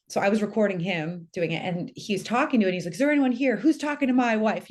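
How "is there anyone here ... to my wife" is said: imitating a husband's challenge, higher and louder; raised, demanding voice of a protective husband, retold; asserting male dominance. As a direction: call out a mock-macho challenge to an intruder. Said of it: "asserting the male dominance".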